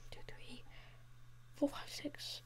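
whispered count; barely audible whispered counting; counting under breath. Real, not acted. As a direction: count almost silently to yourself.

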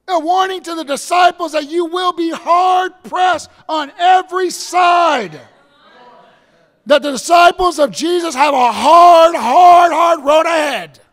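loud, high preaching cry; fiery sermon delivery. Acted. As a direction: thunder it from the pulpit. Preaching at full force.

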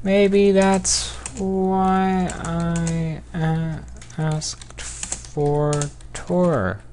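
high, long-drawn words; very slow dictation. Real, not acted. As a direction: stretch every word out while typing. Slowly sounding out typed message.

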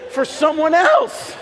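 excited shout. Retold or imitated. His excited reply retold.

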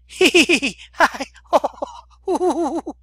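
high, loud "hee hee, ho ho" cackle of a madman; manic cackle. Acted. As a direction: giggle up high like a lunatic.

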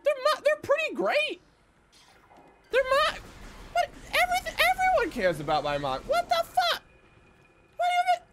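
very high, squeaky indignation; hammed-up offended squeal; mock insulted. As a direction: protest in a wounded falsetto. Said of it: "I'm insulted".

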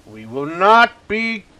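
mock defiant declaration.

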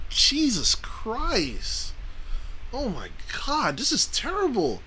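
high, slow, drawn-out incredulity; swooping high-pitched disbelief; appalled disbelief. Real, not acted. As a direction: stretch the words up high, aghast. Disbelief at the airline's policy.

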